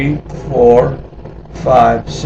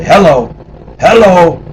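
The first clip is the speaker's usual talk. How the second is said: hello called out higher and louder; calling out; 8 semitones higher, 5 dB louder than usual. Retold or imitated. Greeting the old woman on the stairs, calling out. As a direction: call up the stairs cheerfully.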